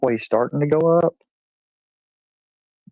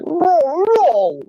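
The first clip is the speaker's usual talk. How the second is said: high, louder dog yelps; dog saying no; 5 dB louder than usual. Retold or imitated. YouTube dog refusing again, high yelped nos. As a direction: bark out the nos. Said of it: "It was like".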